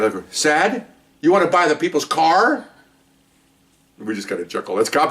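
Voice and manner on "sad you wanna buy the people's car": teasing retort. Retold or imitated.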